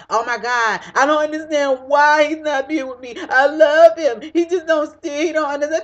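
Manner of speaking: high, overwrought imitation of a lovesick complaint; mock tantrum. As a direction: wail it mockingly, stretching the declaration of love. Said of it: "throwing a big ass tantrum".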